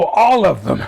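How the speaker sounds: loud, hammed-up enthusiasm; retold eager answer. Diner answering the Italian server, mock eagerness. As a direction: act out greedy delight, loudly.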